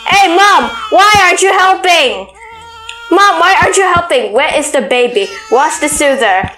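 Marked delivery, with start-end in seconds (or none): 0.1-2.3 calling out to mom. Real, not acted. 3.1-4.3 complaining to mom. Real, not acted. Repeated complaint to game mom.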